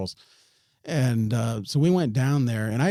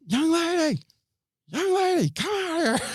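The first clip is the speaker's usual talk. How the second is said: imitated yelling. Retelling calling the girl out of the house, high imitated yell. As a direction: call out high as if to someone indoors. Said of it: "I start yelling the girl's name".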